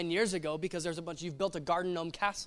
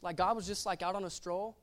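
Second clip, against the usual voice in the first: ordinary talk, nothing marked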